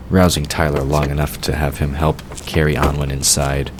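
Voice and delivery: ordinary talk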